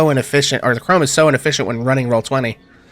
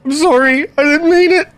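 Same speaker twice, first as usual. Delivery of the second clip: high, rising, begging voice; a guard on his knees begging not to die; pleading for his life; 10 semitones higher, 3 dB louder than usual. Acted. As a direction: beg for mercy, voice climbing higher. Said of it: "and just plead".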